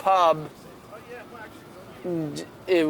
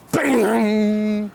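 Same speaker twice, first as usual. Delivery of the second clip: bang; 5 semitones higher, 5 dB louder than usual. Retold or imitated. Imitating glass banged on bar.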